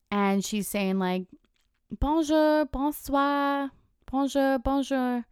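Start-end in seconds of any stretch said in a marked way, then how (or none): 2.0-5.2 lightly put-on French host greeting; mock French host. A host in Paris greets tourists, mock French. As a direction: imitate a chirpy Paris host greeting guests. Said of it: "she's pretending to be a host".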